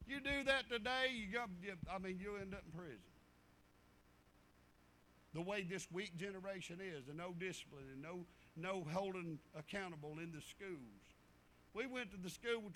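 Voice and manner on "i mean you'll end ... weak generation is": ordinary talk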